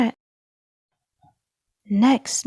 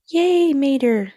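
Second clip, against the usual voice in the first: cheering; 5 semitones higher, 4 dB louder, slower than usual. A narrator's reading. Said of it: "cried Mia".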